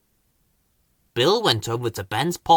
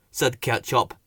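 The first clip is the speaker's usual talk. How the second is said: plain reading voice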